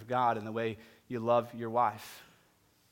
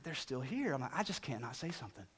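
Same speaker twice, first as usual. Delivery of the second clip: hushed mutter; 12 dB quieter than usual. Retold or imitated. Muttering to friend about couple.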